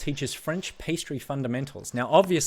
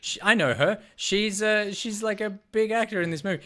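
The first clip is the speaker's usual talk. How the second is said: much higher, fast, eager recognition; a higher, quicker fan's excitement; mock excited recognition; 9 semitones higher than usual. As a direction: gush as if spotting a famous face on screen. Retelling his own thoughts watching a movie.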